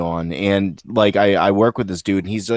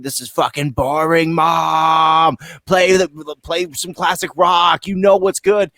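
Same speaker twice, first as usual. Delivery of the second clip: put-on raised-pitch kid pestering mom; mock kid complaining; 6 semitones higher, slower than usual. Child begging mom to change the car radio, mock complaining. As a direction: complain up high like a fed-up kid.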